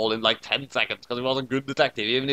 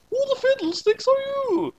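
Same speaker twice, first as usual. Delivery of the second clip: high, shocked mother voice; shrill shocked mom. Acted. Mom shrieking at the stranger at the table, very high. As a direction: squeal it like an outraged mom.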